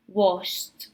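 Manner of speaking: "washed" ending in T; one syllable, "washed" said as "washt"; final T sound. Shown as a demonstration. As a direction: finish "washed" on T, no E vowel. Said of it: "we pronounce it with a T".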